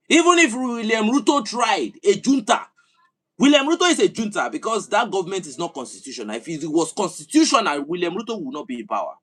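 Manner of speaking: rally the listeners at full force; fiery rant. Real, not acted. Impassioned political rant.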